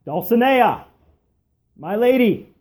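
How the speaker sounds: calls out high and loud from afar; calling out. A narrator's reading. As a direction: call across to her, lifted and loud. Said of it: "calls out both names".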